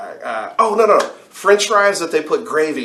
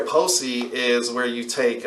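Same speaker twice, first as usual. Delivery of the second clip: usual speaking voice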